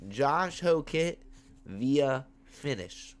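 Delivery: slow, drawn-out prediction; slow emphatic. Real, not acted. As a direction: lay each word down slowly.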